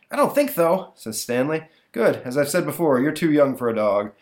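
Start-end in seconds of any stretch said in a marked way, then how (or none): 0.1-0.9 put-on much higher kid voice; a small boy answering his dad; little boy. Stanley voiced in comic. 1.9-4.1 dad voice. Acted.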